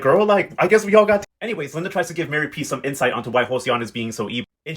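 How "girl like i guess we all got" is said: shook. Retold or imitated. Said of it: "Grandmama Evelina are equally shook".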